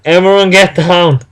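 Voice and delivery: a restrained yell for everyone to hear; mock yell. A narrator's reading. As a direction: call out a warning, not full volume. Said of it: "yelling at the top of their lungs".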